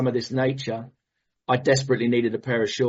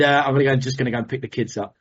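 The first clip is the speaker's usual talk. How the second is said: ordinary delivery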